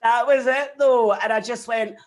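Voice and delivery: laughing it out after the joke. Real, not acted. Laughing loudly at the host's joke about exes.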